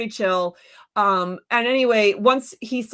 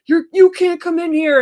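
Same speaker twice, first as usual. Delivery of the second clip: imitating the door guard, raised and high; mock guard yelling; 7 semitones higher, 7 dB louder than usual. Guard at the door turning her away, high mock shout. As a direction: bark it like a bossy guard.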